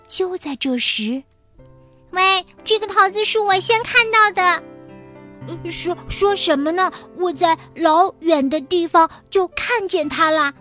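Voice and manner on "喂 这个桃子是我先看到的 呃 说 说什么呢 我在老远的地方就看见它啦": high, loud little mouse arguing; squeaky mouse quarrel. Acted. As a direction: squabble as a tiny mouse. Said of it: "两只小老鼠吵了起来".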